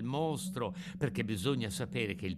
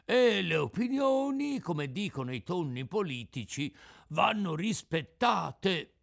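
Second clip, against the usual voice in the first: pompous tuna; 7 semitones higher, 4 dB louder than usual. Acted. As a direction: pronounce it like a tuna politician.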